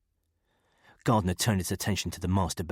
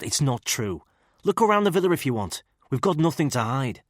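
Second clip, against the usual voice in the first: higher, chummy Liverpool voice, talking him down; a pleading Scouse mate, louder as he goes; Scouse character voice; 5 semitones higher, 4 dB louder than usual. A narrator's reading. Ex-para talking Gardner into lowering the gun, Scouse.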